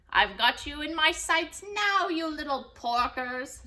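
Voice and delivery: loud, high gleeful witch; cackling witch. Acted. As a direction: gloat at the pigs below. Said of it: "she cackled".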